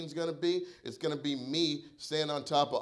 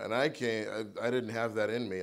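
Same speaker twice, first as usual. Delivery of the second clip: ordinary talk, nothing marked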